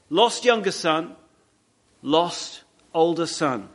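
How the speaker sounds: ordinary talk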